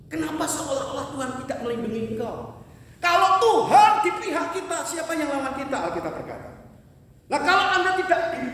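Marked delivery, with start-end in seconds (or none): 3.0-5.8 preaching cry. Acted. Preacher's rhetorical climax.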